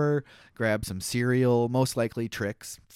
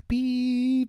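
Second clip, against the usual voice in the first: high, sung-out bleep tone; censor bleep; 13 semitones higher than usual. Shown as a demonstration. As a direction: imitate a radio censor beep. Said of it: "but I'm gonna bleep it out".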